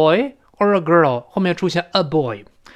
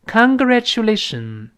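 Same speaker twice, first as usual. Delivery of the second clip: slow, higher "congratulation"; careful teacher's demonstration; read-along model; 5 semitones higher, slower than usual. Shown as a demonstration. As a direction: draw out "congratulation" for repeating. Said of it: "我们再读一遍".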